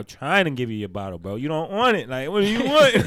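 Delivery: high, loud exasperated dad pleading; worked-up "what do you want?" frustration, acted out; impatient. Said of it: "a little impatient".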